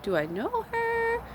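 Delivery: very high, drawn-out mock puzzlement; slow high-pitched bewilderment; puzzled wondering. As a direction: stretch it out high, as if baffled by a stranger. Puzzled by waving stranger.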